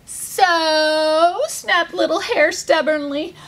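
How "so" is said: long drawn-out sassy "so". A narrator's reading. Little hare snaps back at rhino, sassy. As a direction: stretch it out with stubborn attitude. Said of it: "give me your sassy so".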